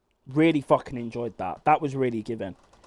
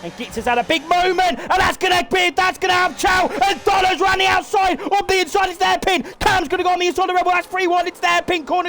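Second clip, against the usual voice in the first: shouting, much higher and louder, words tumbling; breathless screaming commentator; frantic race call; 3 dB louder, faster than usual. Acted. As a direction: yell the chaos at the hairpin as it happens. Frantic last-lap race call.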